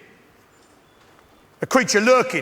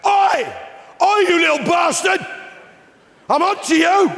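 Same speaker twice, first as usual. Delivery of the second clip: shout at the squirrel; 8 semitones higher than usual. Shown as a demonstration. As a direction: bellow it out to scare the squirrel off. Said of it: "and I shout".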